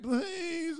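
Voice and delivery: very high pleading whine; pleading teammate. Retold or imitated. Teammate begging friends to stay, a high whining plea. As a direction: beg them not to go, whining. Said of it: "he was pleading".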